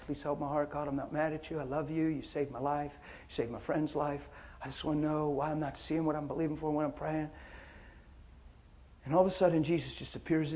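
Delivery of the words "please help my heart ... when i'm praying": soft prayer. Retold or imitated. Adam praying alone on his bed.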